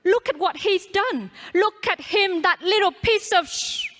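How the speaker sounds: loud, high, urgent shout. Pretend. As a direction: shout it at her across the room. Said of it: "I could shout out to her".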